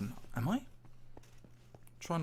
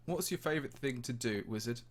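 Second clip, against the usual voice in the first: normal talking voice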